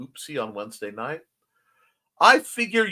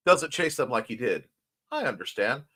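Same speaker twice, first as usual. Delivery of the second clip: ordinary talk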